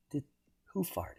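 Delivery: a playful whispered question; mock whisper. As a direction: whisper it like a sneaky joke. Joking aside mid-report.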